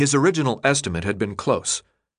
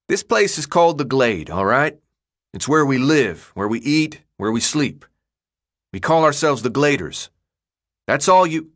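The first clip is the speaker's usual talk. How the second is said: put-on firm, emphatic tough boy leader; lightly voiced gang leader; 4 semitones higher than usual. Leader explaining the place to a newcomer, firm tough voice. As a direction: lay down the basics, in character.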